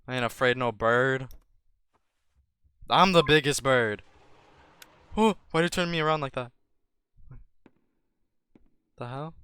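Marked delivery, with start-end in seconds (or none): none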